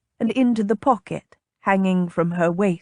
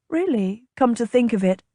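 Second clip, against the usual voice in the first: ordinary delivery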